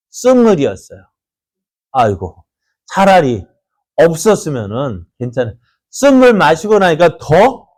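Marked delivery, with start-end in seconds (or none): none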